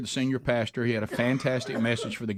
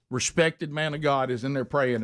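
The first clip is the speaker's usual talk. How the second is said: ordinary delivery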